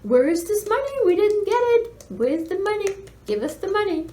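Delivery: hammed-up greedy tax office; mock demanding official. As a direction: parody the county office's nagging demands. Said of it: "we're getting messages from Chatham County".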